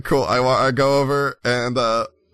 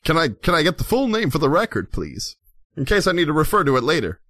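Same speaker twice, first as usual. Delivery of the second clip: Laszlo, officious investigator; 4 semitones higher, faster than usual. Acted. Laszlo taking the bard's name, brisk officious lilt. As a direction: ask briskly with fussy politeness.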